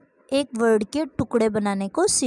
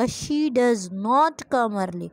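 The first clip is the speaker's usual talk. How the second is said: slow dictation; much slower than usual. Shown as a demonstration. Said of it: "तो कैसे हो जाएगा".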